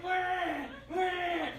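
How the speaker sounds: soft imitated baby crying; a quiet, babyish wah wah; mock baby wail. Dad imitating the newborn crying nonstop, soft mock wail.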